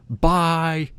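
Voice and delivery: long, high mock call; imitated yell. Imitating the group yelling a second time, mock yell. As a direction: stretch it out as a contained yell. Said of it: "they yell out".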